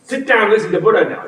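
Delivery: high, sharp command to unruly gods; strict order. Retold or imitated. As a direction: snap them into line. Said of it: "he whips them, like".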